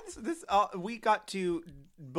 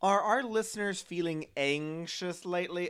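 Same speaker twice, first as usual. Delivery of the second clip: ordinary talk, nothing marked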